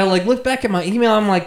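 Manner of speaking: usual speaking voice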